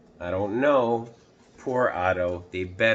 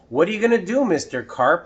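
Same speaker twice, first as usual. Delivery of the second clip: higher, louder curious ask; eager question; 7 semitones higher, 5 dB louder, faster than usual. Acted. Boy asking Mister Carp, high and curious. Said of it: "I asked him".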